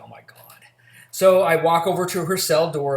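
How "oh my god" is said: a muttered, much softer oh my God under the breath; exasperated groan. Retold or imitated. Officer fed up with the inmate calling again, exasperated mutter. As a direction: sigh it out like you've had enough.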